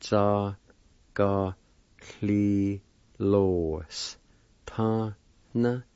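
very slow, each syllable held on its own. Shown as a demonstration. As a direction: sound it out with long gaps between syllables. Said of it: "it's pronounced like this".

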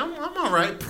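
high, coy little reply; coy girlfriend voice. Acted. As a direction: play it shy and pleased, voice up high. Girl's coy reply, acted.

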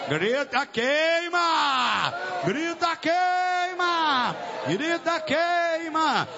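high, long-drawn preacher's cry; rallying cry. Real, not acted. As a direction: lead the crowd's shout, stretching the last word. Leading congregation to shout "burn. (brado agudo e prolongado de pregador)

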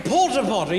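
high-class British accent. Shown as a demonstration. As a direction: make "porta potty" sound sophisticated and British. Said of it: "everything you say in a high-class British accent sounds sophisticated".

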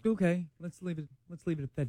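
very soft, hushed sleep voice. Shown as a demonstration. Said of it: "Oh, that's your sleep voice".

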